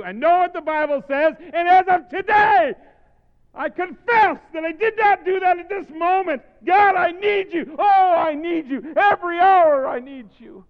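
high, very loud cry to God; slow, drawn-out pleading at full voice; impassioned shouted prayer. Real, not acted. Cries out a prayer.

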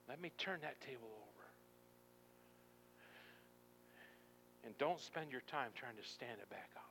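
soft, gentle pleading with long pauses; hushed preaching. Acted. God asking to turn the table over, hushed pleading. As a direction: drop right down and plead quietly.

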